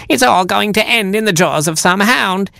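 mock vixen baiting the hounds; foxy voice, rising. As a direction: build to a shrill come-get-me. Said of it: "in a foxy voice".

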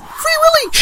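very high, piping exclamation; squealed cry. Acted. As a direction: squeal it as the whale leaps. Cry as whale leaps.

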